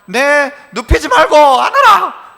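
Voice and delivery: slow, laughing mock baby demand; voicing the baby. Preacher voicing what the crying baby means, slow and amused. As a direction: speak for the baby, amused and drawn out. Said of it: "요 말 하는 거죠".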